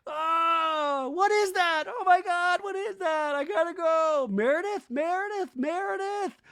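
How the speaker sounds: very high, loud panicked wailing; hammed-up crying terror; mock hysterical screaming. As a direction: shriek and wail as if lost in the woods, overdone. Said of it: "all screaming and making noise".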